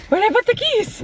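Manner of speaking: high, exasperated outburst. Real, not acted. Vlogger hunting for lost car keys, exasperated. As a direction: pitch jumps up in exasperation.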